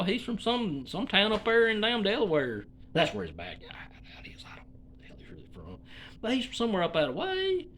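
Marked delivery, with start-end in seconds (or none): 2.9-5.8 softer, faster whispered aside; hushed throwaway whisper. Real, not acted. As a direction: rush it out under your breath. Muttered aside to himself.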